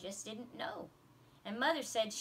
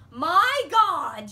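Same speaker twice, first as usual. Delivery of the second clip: loud high gasp of shock; girl shocked by the nativity story; shocked outburst; 9 semitones higher, 16 dB louder than usual. A narrator's reading. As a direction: blurt it out stunned.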